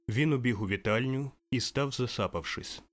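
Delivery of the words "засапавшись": usual speaking voice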